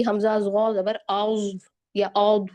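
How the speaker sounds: "औद" slowly with a full, heavy mouth; full-mouthed dhaad. Shown as a demonstration. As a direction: round and thicken the mouth on "औद". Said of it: "मुंह भर के मोटा करके ये जौद अदा हो रहा है".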